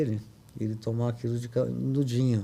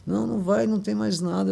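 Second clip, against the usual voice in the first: insistent denial; 8 semitones higher than usual. Retold or imitated. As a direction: push back, sure he is cured. Sister-in-law insists he's cured.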